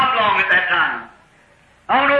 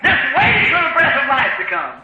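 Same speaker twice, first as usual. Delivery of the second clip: full-force preaching; 6 semitones higher than usual. Acted. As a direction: preach it out to the congregation, rising.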